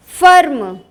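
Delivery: normal talking voice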